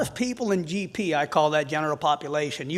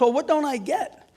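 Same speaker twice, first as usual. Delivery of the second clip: puzzled; 9 semitones higher, 4 dB louder than usual. Retold or imitated. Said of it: "I said".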